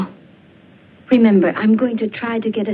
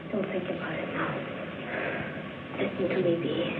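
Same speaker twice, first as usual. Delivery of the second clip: calm her down under your breath; hushed soothing; 2 semitones lower, 11 dB quieter than usual. Acted. Friend telling the patient to listen, hushed and soothing. Calming patient, secret talk.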